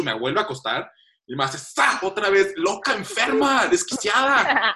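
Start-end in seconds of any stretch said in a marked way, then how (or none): 1.6-2.0 mock rip sound. Sound of wax ripped off. 2.0-4.7 outraged. Retold or imitated. Outraged at the waxer.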